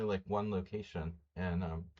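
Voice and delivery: ordinary talk, nothing marked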